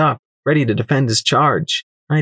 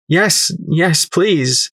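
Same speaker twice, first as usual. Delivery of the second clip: higher, urgent yes; eager pleading; 6 semitones higher, much slower than usual. Acted. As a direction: beg without hiding the need. Eager plea to the merchant.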